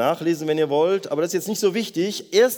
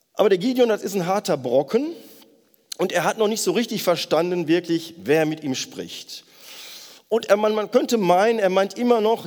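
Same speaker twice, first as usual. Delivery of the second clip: normal talking voice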